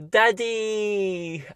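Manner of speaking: put-on high toddler cry of Daddy; little kid calling. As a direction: call out like a toddler. Baby voiced calling out.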